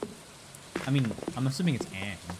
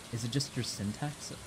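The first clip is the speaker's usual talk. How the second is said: ordinary talk, nothing marked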